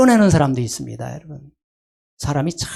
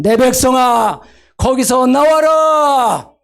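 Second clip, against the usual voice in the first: high, loud, slow commanding shout; God's urgent call; 8 semitones higher, 3 dB louder than usual. Acted. God calling his people out of Babylon, shouting urgently. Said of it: "얼마나 하나님께서 답답했으면".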